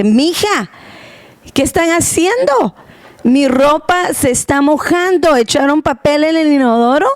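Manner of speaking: a hint of an alarmed mom; imitating mom. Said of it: "mi mamá ... me dice".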